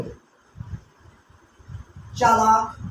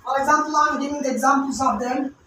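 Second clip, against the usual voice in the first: normal talking voice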